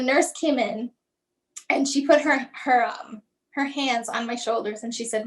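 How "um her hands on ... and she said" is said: normal talking voice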